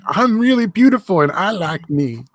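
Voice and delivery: mocking voice. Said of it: "a fat bitch who was like".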